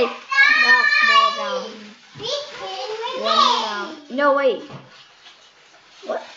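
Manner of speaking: very high, loud, drawn-out shrieks; watching the last players fall, very high excited shrieks; frantic yelling. Real, not acted. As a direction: shriek each word at the game.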